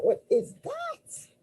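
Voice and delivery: real astonishment, voice jumping up; startled question. Real, not acted. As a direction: genuinely caught off guard by the card. Card popped out unexpectedly.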